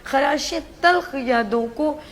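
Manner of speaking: slow, heartfelt verse recital; poetry recitation. Acted. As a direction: recite a farewell couplet with feeling. Anchor's farewell couplet. (جذباتی انداز میں شعر پڑھنا)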